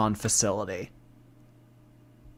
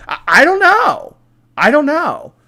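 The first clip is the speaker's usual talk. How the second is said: high, louder flustered protest; exasperated; 12 semitones higher, 5 dB louder than usual. Real, not acted. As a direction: throw up your hands. Flustered defensive outburst.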